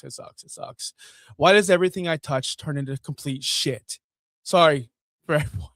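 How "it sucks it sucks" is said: says it softer each time until it is a whisper; dejected, trailing off into a whisper; fading to a whisper. Real, not acted. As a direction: let it die away to a breath. Repeating in dejection.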